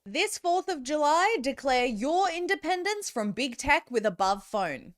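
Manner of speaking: upbeat sponsor read, bright pitch. Acted. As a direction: sell it like a radio ad.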